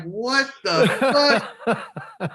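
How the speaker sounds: drawn-out, high, half-laughing; laughing disbelief. Retold or imitated. Telling the podcast host about finding the mess, laughing in disbelief. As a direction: stretch it slowly through a laugh.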